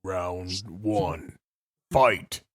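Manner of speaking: deep announcer. Retold or imitated. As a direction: boom it like the game announcer.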